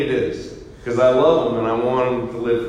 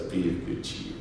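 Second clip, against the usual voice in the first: whispered gently; 5 semitones lower, 11 dB quieter than usual. Shown as a demonstration. Same line whispered after grumpy act.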